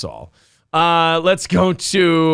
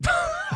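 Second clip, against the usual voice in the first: high squeal laugh; 8 dB quieter than usual. Real, not acted. Bursts out laughing.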